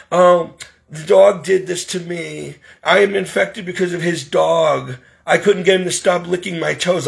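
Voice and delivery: put-on victim voice; mock complaining patient. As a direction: imitate someone pitifully passing the blame. Mimics Donna blaming dog to doctor.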